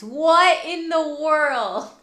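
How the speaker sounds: slow, loud disbelief; drawn-out what in the world; disbelieving. Real, not acted. Reacting to chat message.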